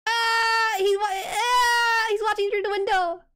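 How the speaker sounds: ordinary delivery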